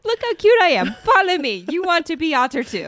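very high, loud cutesy creature; hammed-up, excited critter voice; cute luring otter. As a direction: coax them along like a perky otter. Plays otter luring friends.

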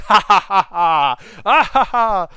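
loud cackling mad scientist laugh; evil scientist laugh. Pretend. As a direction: throw back your head and cackle like a villain. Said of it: "Maybe do, like, a little evil scientist laugh".

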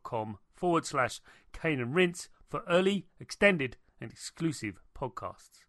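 soft, steady announcer sign-off; podcast outro read. Acted. Host closing the podcast for listeners, soft announcer read. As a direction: read the sign-off like a recorded promo.

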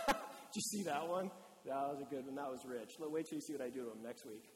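chuckle, then low gloating; low, amused sneering aside. Acted. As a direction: play a mock spectator enjoying someone's failure. Mocks a God who enjoys failures.

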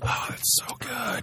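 breathy whisper of delight. Real, not acted. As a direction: whisper it like a treat. Savouring the gin shot.

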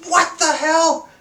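high, loud mock outburst; retold angry what the hell. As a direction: burst out in disbelief. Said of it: "I just got angry".

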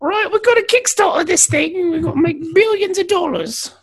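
squeaky high mock Brit; mock Python pitch. Pythons plotting a Kickstarter, high mock voice.

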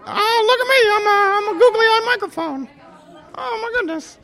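tiny excited toy character; squeaky puppet microphone. Pretend. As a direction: play the delighted talking microphone. Googly-eye microphone puppet talking.

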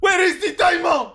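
shouted high Blood Diamond line; Blood Diamond impression. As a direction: bark it high like the film. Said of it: "This is from Blood Diamond".

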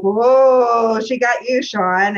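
loud, drawn-out whoa; teasing group jeer. Retold or imitated. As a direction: imitate colleagues razzing their boss loudly. Team jeering at the boss, retold.

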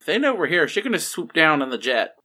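ordinary delivery

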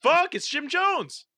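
high, loud, stretched-out exclamation; excited reveal. Real, not acted. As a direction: blurt out the big reveal. Reveals the winning answer.

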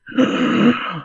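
sharp high delighted gasp; excited gasp. Acted. Excited reveal of new treat.

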